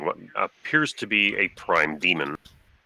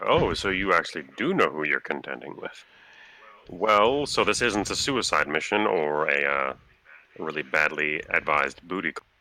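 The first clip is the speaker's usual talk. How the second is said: ordinary talk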